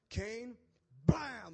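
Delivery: sudden loud blast; mock divine smiting. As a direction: call the name, then explode on the sound word. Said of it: "He's not just like".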